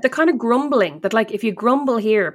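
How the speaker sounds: normal talking voice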